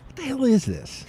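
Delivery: high, exasperated mock grumble; mock complaint. Friend at the dive bar hating the crickets, mock grumble. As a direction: play the annoyed friend.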